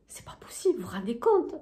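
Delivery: hushed aside; whisper. Real, not acted. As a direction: whisper it to the audience. (chuchoté)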